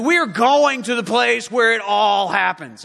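loud, emphatic rallying cry; preacher shouting as a leader rallying his men; rousing shout. Acted. Voicing Jesus rallying the disciples.

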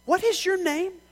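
loud, high shouted demand; angry shout. A narrator's reading. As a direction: demand it in a rage.

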